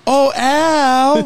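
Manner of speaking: high, loud mock wail of his name; hammed-up Peg Bundy wail; mock cry of Oh Al. Husband imitating his wife wailing his name in mock despair. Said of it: "for a week straight I heard".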